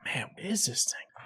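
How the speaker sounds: hushed whisper to himself. Retold or imitated. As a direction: whisper it, puzzled. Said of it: "I'm like".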